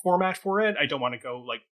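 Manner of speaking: normal talking voice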